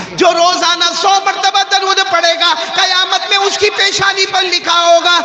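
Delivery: high, loud preaching cry; preaching at full force. Acted. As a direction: call it out over the crowd with fervour.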